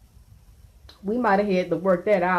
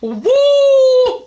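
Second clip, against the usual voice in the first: excited whoop; 8 dB louder than usual. Real, not acted. Cheering a guest's wild story.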